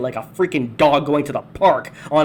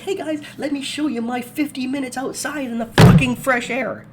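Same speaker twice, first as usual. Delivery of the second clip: higher, louder bubbly voice; mock happy vlogger; 10 semitones higher, 3 dB louder than usual. Mocking the woman filming herself, higher bubbly gush. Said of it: "She's all happy".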